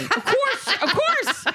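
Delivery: high laughing of course; laughing agreement. Real, not acted. Host agreeing with the guilty mom, laughing.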